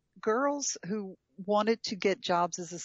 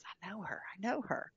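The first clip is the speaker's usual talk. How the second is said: quoted aside; 8 dB quieter than usual. Retold or imitated. Retelling husband's recognition of a lady.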